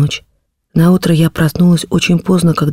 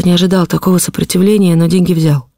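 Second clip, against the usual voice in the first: usual speaking voice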